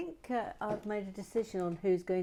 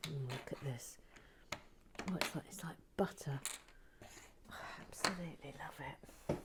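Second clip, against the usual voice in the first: delighted whisper; 3 semitones lower, 11 dB quieter than usual. Acted. Delighted whisper over tool.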